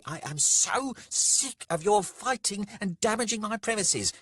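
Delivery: high-pitched, irate landlady tirade; screeching landlady. A narrator's reading. Landlady orders two brawlers out of her bar, screeching. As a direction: rant shrilly at the top of the register.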